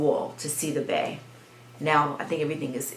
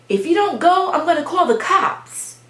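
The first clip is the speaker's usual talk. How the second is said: high, raised-voice threat; retold shouted threat; 11 semitones higher, 6 dB louder than usual. Neighbor threatening children with police, imitated high shout. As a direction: mimic an angry neighbor's warning.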